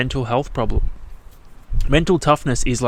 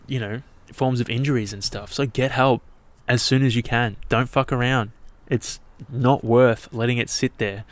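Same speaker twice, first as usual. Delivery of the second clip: ordinary talk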